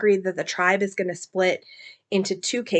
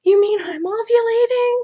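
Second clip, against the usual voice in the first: very high, louder squeal on "ovulating"; amazed disbelief; 5 dB louder than usual. Retold or imitated. Kira realizing she can have a baby, high amazed squeal. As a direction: burst out with delighted shock. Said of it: "she's all like".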